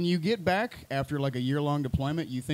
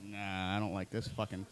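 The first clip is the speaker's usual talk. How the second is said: low grumble; 7 semitones lower, 10 dB quieter than usual. Retold or imitated. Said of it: "I'm like".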